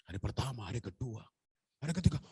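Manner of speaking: ordinary delivery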